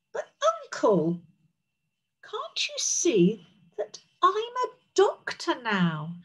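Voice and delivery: high, drawn-out appeal; protesting princess. Acted. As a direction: plead your case to your uncle. Princess protests to scolding uncle.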